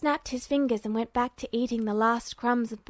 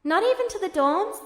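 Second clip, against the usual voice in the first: confused; 8 semitones higher than usual. A narrator's reading. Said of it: "Alphonse sounded confused".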